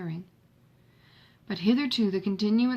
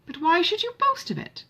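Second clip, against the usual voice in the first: put-on much higher and louder, an eager indignant young woman; Marianne's eager challenge; 11 semitones higher, 4 dB louder than usual. A narrator's reading. Marianne challenging Edward on his boast, higher and louder, eager. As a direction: toss the question back at him, higher and louder.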